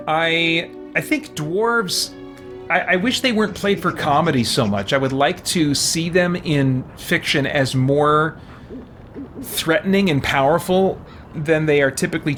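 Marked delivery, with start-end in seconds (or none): none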